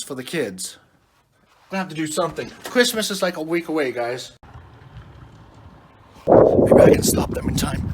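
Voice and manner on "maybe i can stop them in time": breathy whisper to the camera; whispered aside. Real, not acted. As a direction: let viewers in on a secret.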